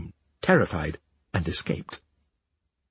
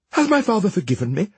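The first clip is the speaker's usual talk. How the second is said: quick, higher, hopeful question; eager; 7 semitones higher, faster than usual. A narrator's reading. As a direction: rush it out full of hope. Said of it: "asked eagerly".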